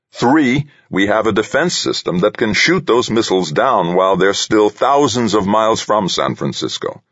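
president voice. Acted. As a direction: lay out the points firmly. President character voice.

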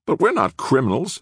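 put-on high-pitched young man's protest. A narrator's reading. Nick to the public defender, protesting. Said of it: "Nick protested".

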